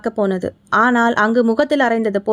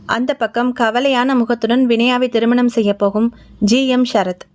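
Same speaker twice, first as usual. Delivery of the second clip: plain reading voice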